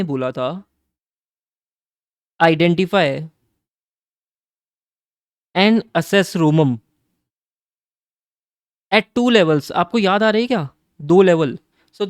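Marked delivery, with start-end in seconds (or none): 2.4-9.7 louder, slow, each word pressed; reminding students what SA 315 says, loud and deliberate; emphatic quote. Shown as a demonstration. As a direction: quote the standard like it's carved in stone. Teacher quotes the standard, emphatic.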